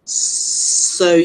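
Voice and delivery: ordinary talk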